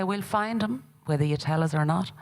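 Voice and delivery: threat. Acted. Agamemnon threatening Hecuba.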